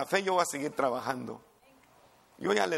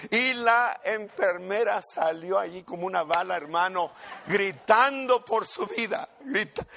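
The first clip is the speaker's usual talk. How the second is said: louder, higher dramatic telling; excited retelling; 5 semitones higher, 5 dB louder than usual. A narrator's reading. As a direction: build the thrill of the escape. Dramatic climax of the story. (narración emocionada y fuerte)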